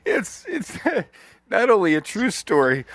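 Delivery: high voice still caught in laughter; laughing hard. Real, not acted. Insisting the story is true, laughing hard. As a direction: struggle to speak through real laughing. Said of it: "I'm laughing so hard".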